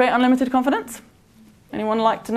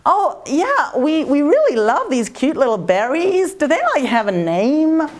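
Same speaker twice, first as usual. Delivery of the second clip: put-on high, breezy American tourist accent; 5 semitones higher, slower than usual. Waitress mimics an American diner asking about berries, bright American accent. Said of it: "I'm really useless at accents".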